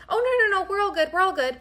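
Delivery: high, hurried reassurance; flustered quote. Retold or imitated. The date reassures the waitress, flustered and high. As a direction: brush it off nervously. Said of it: "And he was like".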